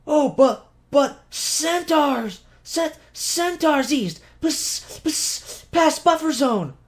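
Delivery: a frantic little cat stumbling over her words; panicked cat creature. A narrator's reading. Bibby the cat warning the group of centaurs, panicked squeak. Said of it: "Bibby stood on her hind legs".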